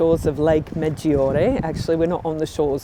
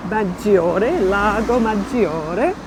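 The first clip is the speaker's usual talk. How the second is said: put-on playful Italian accent, pitched up; silly Italian accent; 4 semitones higher, 3 dB louder than usual. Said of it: "speaking in a silly Italian accent".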